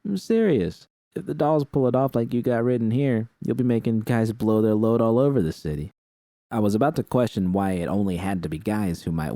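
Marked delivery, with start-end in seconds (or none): 0.0-5.9 V's higher voice. Acted. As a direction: lift the pitch for V, earnest.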